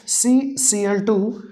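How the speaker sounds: very slow, letter by letter; slow dictation. Shown as a demonstration. Tutor repeating the formula for students to write, very slowly. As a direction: spell it out for students. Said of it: "repeats formula for dictation".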